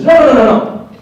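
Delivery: high loud repeated no; emphatic denial. Retold or imitated. Sister shouting down her brother's mistake, loud. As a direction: cut him off hard. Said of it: "She says".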